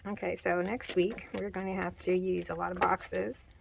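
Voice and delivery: usual speaking voice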